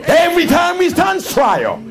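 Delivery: fervent shouted sermon; rousing pulpit shout; preaching at full force. Acted. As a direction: preach it to the rafters.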